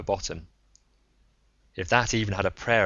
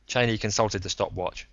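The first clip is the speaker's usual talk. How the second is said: plain reading voice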